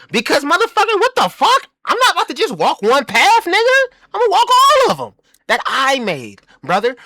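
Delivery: high, loud hyped-up bragging; boastful yell. Acted. Bragging about making his own path, yelling high. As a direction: shout it like you can't be stopped.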